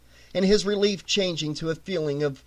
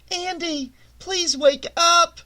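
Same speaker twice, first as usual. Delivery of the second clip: higher, slowed faraway plea; distant woman calling; 10 semitones higher, 3 dB louder, slower than usual. A narrator's reading. Gene calls Andy from outside the dream, distant and high. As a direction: call him back from far away.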